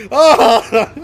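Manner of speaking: high, loud mock scream; comic yelp back at the yelling; retold scream. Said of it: "I was like, I was like".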